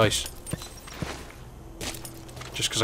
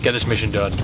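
ordinary talk, nothing marked

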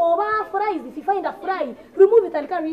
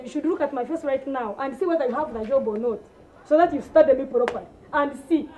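normal talking voice

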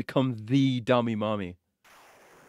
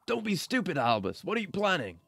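high-pitched put-on woman's voice; acted alarm, pitched up; alarmed female character; 7 semitones higher than usual. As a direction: plead with the rival in a high voice. Female character confronts the rival.

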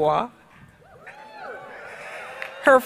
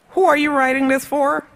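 mock incredulous; 7 semitones higher, 5 dB louder than usual. Friends critiquing first speech draft.

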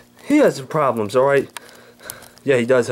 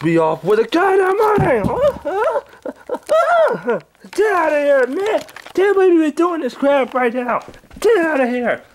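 Angry Grandpa voice. Acted. Old man woken by pranksters, high cranky yelling. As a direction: bark at the intruders like a furious grandpa.

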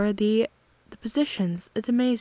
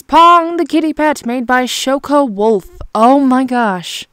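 excited gushing; 2 semitones higher, 9 dB louder than usual. Real, not acted. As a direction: announce a favorite with a loud, thrilled squeal. Gushing over a favorite fan art character.

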